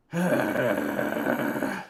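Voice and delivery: long, low exasperated sigh; heavy sigh. Real, not acted. Fan sighing at his collapsing defense, weary. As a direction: let it all out in one sigh.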